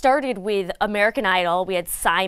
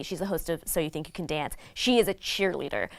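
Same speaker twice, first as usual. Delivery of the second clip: ordinary delivery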